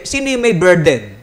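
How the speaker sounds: ordinary talk, nothing marked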